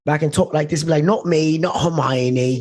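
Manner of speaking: put-on British film accent, slowing at the end. Guest retelling the actor's Harry Potter example, British accent. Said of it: "if I'm watching Harry Potter".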